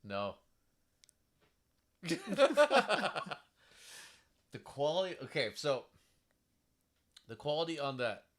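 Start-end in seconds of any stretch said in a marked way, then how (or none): 2.0-3.4 loud, high burst of laughter; real laugh, high and loud. Real, not acted. After admitting to not watching Gallavant, a laugh. Amused reply after saying no.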